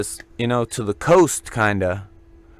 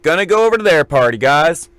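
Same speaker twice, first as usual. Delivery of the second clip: louder, higher, fast eager pitch; mock eager party call, louder; 6 semitones higher, 3 dB louder than usual. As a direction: hype up the guys to go over. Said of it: "And I'm like".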